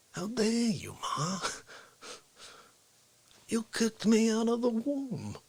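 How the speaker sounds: high, soft, slow simmering grievance; Dick Facce. Acted. Son accusing his mother, softly aggrieved character voice. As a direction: hold back the mock outrage, stretching words. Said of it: "That's what Facce does".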